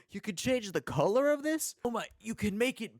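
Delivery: high, loud wide-eyed amazement; Harry Manlegs. Retold or imitated. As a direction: gush like a kid finding new toys. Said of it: "feels like Harry Manlegs discovering the Maker Pen tools for the first time".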